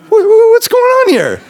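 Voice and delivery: high frightened squeal, half laughing; a panicked kid's high squeak; scared. Retold or imitated. Little brother alone in the dark, squeaking in fright.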